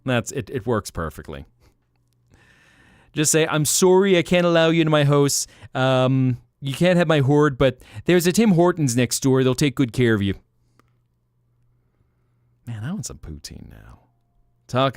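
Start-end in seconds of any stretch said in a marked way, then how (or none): 3.5-10.3 put-on overdone polite Canadian accent, a bit higher, louder and quick; hammed-up apologetic Canadian dragon turning a visitor away; dreadful mixed Canadian accents. Dragon politely refusing a visitor, in a hammed-up Canadian accent. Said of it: "dreadful amalgamation of Canadian accents".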